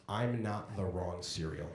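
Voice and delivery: sheepish last words. Retold or imitated. Replaying the last words before dying, softly. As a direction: mutter it like sad final words.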